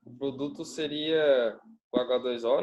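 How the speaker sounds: ordinary talk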